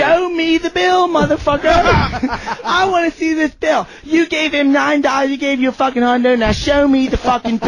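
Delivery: high-pitched mock-furious rant; imitated angry demand. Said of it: "Novak goes in so pissed off".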